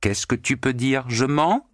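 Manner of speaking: high taunting cry; defiant challenge. A narrator's reading. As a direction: dare him to deny it. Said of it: "criait Natacha au porc gras".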